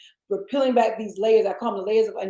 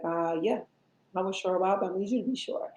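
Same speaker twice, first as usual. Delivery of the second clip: low, soft, calm answer; soft low reply; 4 semitones lower, 8 dB quieter, faster than usual. Retold or imitated. Voicing God answering softly.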